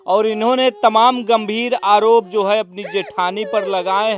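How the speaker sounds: loud, projected reporter's read; field news report. Acted. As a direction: announce the story to camera. Reporter on-scene piece to camera.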